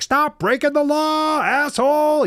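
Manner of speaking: very high, drawn-out movie scream; Jim Carrey. Retold or imitated. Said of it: "he starts screaming".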